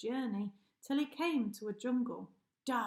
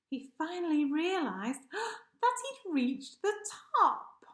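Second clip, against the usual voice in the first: excited gasp; 6 semitones higher, 4 dB louder than usual. A narrator's reading. Narrator excited at summit.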